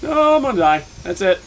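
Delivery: loud, high wail of doom; panicked yell. Real, not acted. Gamer caught by the demon, loud high despairing cry. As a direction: cry out like you're about to die. Monster catches him.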